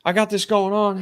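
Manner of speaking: flustered friend on the phone, reenacted; retold panic. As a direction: blurt it like a rattled coworker. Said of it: "I can hear the panic in his voice".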